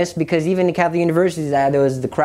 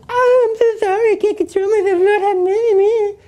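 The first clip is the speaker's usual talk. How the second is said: very high sing-song taunt; creepy high-pitched mock sobbing; mocking demon voice. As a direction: mimic a penitent's plea in a creepy whine. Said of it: "and mocking me".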